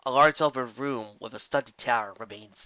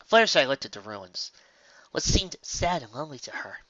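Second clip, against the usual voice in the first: ordinary delivery, nothing marked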